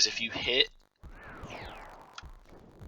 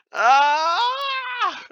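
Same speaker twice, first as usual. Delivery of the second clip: frustrated shout; 6 dB louder than usual. Real, not acted. Fails achievement.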